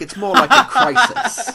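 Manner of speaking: high, loud, laughing impression of a campy old-school hero; Adam West. Batman arrives in the Batcave with his line, hammy and laughing. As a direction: ham it up like a sixties TV Batman, laughing through it. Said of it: "Adam West comes out and he's like".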